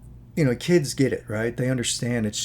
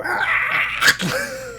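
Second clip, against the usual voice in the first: breathless voiceless mock scream; a quick faked shriek of attack; 5 dB louder than usual. Baby attacking the rabbit, short breathless mock scream. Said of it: "look at the rabbit and go".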